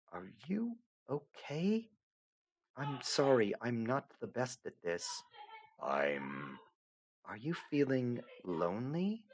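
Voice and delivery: lightly voiced young heroine, gently insistent; Aradia, softly puzzled. Aradia lost in the void, pleading softly to the narrator.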